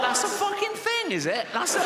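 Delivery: very high, incredulous outburst; mock shock. Seven-year-old reacts to mum's warning, high-pitched mock horror. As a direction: shoot the pitch right up in comic disbelief. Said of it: "I was like".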